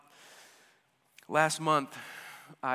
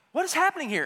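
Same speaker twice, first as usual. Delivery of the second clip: baffled; 11 semitones higher, 5 dB louder, much faster than usual. Retold or imitated. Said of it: "And I was like".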